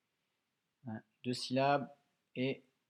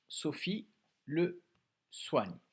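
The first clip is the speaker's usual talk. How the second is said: ordinary talk, nothing marked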